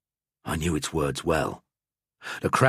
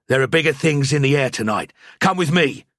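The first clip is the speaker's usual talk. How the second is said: louder, higher, pressing urgency; urgent priest; 7 semitones higher, 5 dB louder than usual. A narrator's reading. Priest pulling his friend away, urgent and louder. Narrator voices himself urgently.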